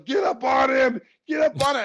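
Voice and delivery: high, loud urgent coach yell; imitating a coach hollering. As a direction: yell orders at your player from the sideline. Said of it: "I hear Phil yelling".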